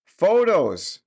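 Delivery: high, loud roar of one word. A narrator's reading. Husband roaring at his deaf wife, loud roar. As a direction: roar it in exasperation. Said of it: "roared her husband".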